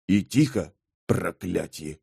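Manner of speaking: low hiss sinking very soft; hissed warning. A narrator's reading. Mage waking a soldier among sleepers, hissing quietly. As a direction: hush them with a muttered curse. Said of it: "прошипел Бен".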